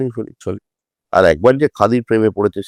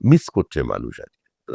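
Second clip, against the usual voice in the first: usual speaking voice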